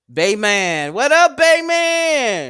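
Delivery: high, drawn-out, slowed hail to a friend; sing-song greeting. Real, not acted. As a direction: stretch the greeting up high and loud. Greeting viewer in chat.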